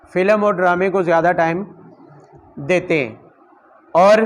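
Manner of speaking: normal talking voice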